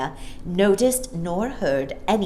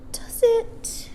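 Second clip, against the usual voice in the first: airy breathy whisper, pitched up. Acted. Mary Poppins to the child, true whisper. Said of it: "she whispered".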